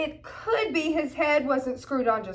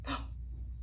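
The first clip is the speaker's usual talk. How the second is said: sharp gasp; 15 dB quieter than usual. A narrator's reading. Grinch struck by an idea.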